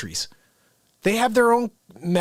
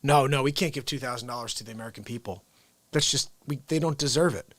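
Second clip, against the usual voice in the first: mock Congress; 5 semitones lower, 5 dB quieter, faster than usual. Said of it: "these people shot it down".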